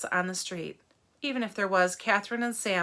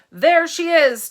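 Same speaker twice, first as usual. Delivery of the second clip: loud and high, calling out; loud mob leader yell; shout; 8 semitones higher, 13 dB louder, slower than usual. Acted. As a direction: point her out to the mob.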